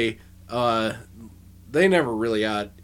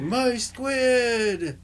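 Alan Moore; 10 semitones higher than usual. Retold or imitated. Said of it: "He's like".

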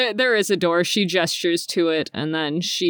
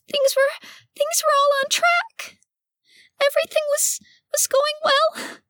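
very high, squeaky creature voice near tears; tearful duck lady. Acted. Cecily explaining herself to the party in tears, very high and squeaky. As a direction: squeak it out high, choked with longing.

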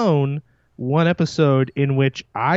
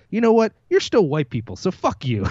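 retold retort; 7 semitones higher than usual. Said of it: "I just turned and said".

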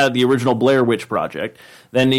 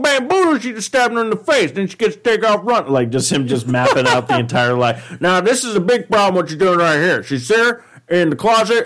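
John Madden impression; 7 semitones higher than usual. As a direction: break down the scene like a hyped coach. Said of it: "he'll be like".

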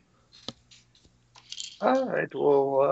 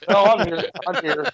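louder, higher insisting; calling out; 7 semitones higher, 4 dB louder than usual. Real, not acted. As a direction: call back urgently. Calls out he's still there.

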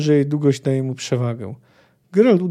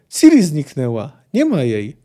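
louder alarmed blurt; panicked cry; 6 dB louder than usual. A narrator's reading. Poet tells Geralt Ciri vanished, louder and alarmed. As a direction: blurt out the bad news in alarm. Panicked news of missing girl.

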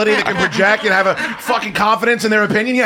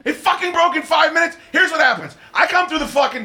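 furious rant; 10 semitones higher than usual. Real, not acted. Host yelling at crew over a broken mic, furious shout.

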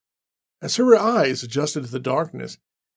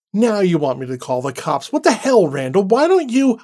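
much higher, louder furious retort; angry outburst; 6 semitones higher, 6 dB louder than usual. Acted. Annie snapping back at Randall, high angry outburst. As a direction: throw it back at him angrily.

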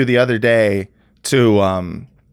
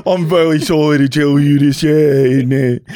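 mock sorry doctor; 3 dB louder than usual. Mock doctor breaking news.

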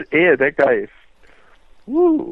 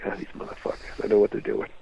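muttered aside; 4 semitones lower, 10 dB quieter than usual. Retold or imitated. Dad muttering to himself.

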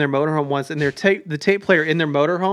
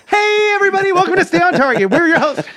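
imitating the host intro; 11 semitones higher, faster than usual. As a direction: mimic the cohost's big intro. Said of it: "right before Chris goes".

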